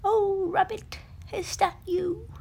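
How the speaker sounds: a blinded bear hearing a friend; relieved Pooh. Acted. Pooh answers Rabbit, relieved but unable to see. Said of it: "said the leafy covered monster, Pooh".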